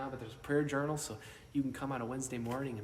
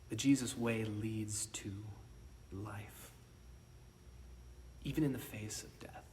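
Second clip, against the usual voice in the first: solemn whisper; 3 semitones lower, 6 dB quieter than usual. Real, not acted. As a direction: lean in and whisper it.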